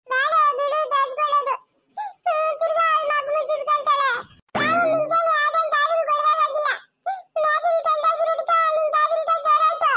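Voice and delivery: put-on high, tearful child's protest; whining through tears; crying. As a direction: refuse comfort in a high wail. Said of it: "ಸುಮ್ನೆ ಅಳ್ಬೇಡ".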